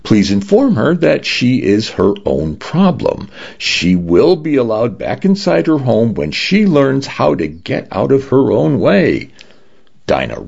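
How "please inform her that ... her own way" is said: higher, lightly voiced pleasant system announcer; a hint of a pleasant automated woman; pleasant female voice. A narrator's reading. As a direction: deliver it politely in a raised pitch. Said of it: "said a pleasant female voice".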